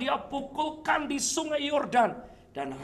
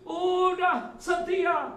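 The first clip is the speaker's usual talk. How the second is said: high, drawn-out indignant protest; frustrated outburst; 7 semitones higher, 4 dB louder, slower than usual. Acted. Elisa raging after serving faithfully, high frustrated outburst. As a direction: wail it like someone fed up. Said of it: "Elisa ngamuk, Elisa frustrasi".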